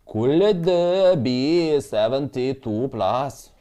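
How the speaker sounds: slow, stretched-out wondering question; drawn-out musing. Real, not acted. Trader studying the chart, slow drawn-out musing. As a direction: draw each word out like thinking aloud.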